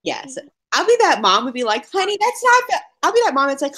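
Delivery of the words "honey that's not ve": higher-pitched pretend mom voice; mock chiding mom. Mom playfully correcting her cursing kid, mock chiding. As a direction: lightly chide a child, pitched up. Voicing a scolding future mom.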